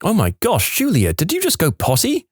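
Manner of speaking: high, gushing "Oh my gosh"; excited mum. Retold or imitated. Mum praising toddler Julia's first potty, high excited gush. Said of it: "her mum got all excited".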